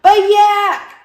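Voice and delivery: very high, loud yell. Acted. Walrus yells at Yak, very high and loud. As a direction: yell to cut him off. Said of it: "yelled Walrus".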